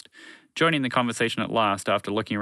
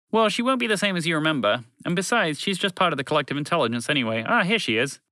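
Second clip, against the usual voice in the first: higher-pitched, breezy explanation; chatty alien voice, raised pitch; lightly voiced alien creature; 7 semitones higher than usual.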